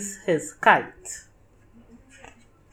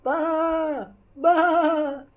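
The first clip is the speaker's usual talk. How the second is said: higher bleating sheep; 10 semitones higher than usual. Acted. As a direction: bleat it like a lamb. Animals answer in the fog.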